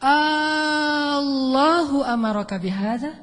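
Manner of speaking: Allahu drawn out slowly, rising to a question; asking intonation. Retold or imitated. Hajar asking Ibrahim, long A, questioning rise. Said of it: "dengan pertanyaan yang benar".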